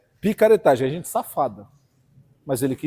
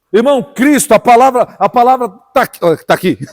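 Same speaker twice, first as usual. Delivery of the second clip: rising, forceful pulpit voice; impassioned preacher to the congregation; fiery preaching; 5 semitones higher, 6 dB louder than usual. Acted. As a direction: build the sermon up and drive it home.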